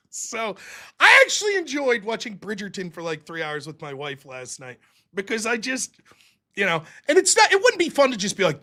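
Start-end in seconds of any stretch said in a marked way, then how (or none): none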